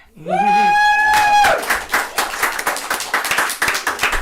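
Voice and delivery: long, very high squealing laugh. Real, not acted. Long laugh at final winner.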